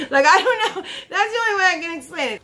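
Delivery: high, laughing through the words; real giggle running through the speech; amused laughing. Real, not acted. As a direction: laugh while you shrug it off. Laughs at own description.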